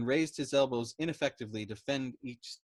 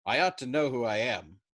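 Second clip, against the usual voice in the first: louder exasperated protest; exploding; 7 dB louder than usual. A narrator's reading. Yossarian insisting on his identity to the major, louder. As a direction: insist hard on it. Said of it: "Yossarian exploded".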